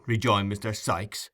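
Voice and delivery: ordinary delivery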